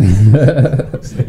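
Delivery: can't get the words out for laughing. Real, not acted. Laughing mid-story.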